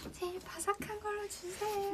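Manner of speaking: high, slow, sweet pleading; cute aegyo voice. Retold or imitated. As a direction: act cute to the vendor. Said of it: "애교 부렸어".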